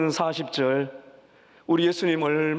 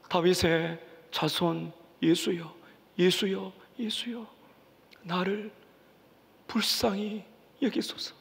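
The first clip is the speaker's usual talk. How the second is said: fading plea; 4 dB quieter, slower than usual. Acted. Preacher voices the blind man's plea.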